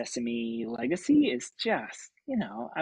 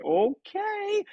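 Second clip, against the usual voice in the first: high squeaked okay, much higher; a tiny nervous okay, pitched way up; squeaky okay; 6 dB louder than usual. Retold or imitated. Newcomer squeaks okay to the director, nervous and high. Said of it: "I was like".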